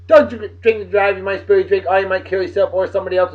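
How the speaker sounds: ordinary delivery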